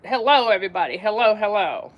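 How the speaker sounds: ordinary talk, nothing marked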